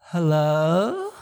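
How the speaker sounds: low, composed mock-pleasant answer; put-on smooth, rising hello; sweet phone greeting. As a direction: answer the phone as if all is calm. Sudden composed phone answer after yelling.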